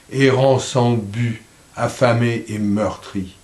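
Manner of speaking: steady, solemn verse reading, softening at the close; poem recitation. Acted. Reader reciting verse on barbarians ravaging the land, solemn recitation. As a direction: let each line settle before the next.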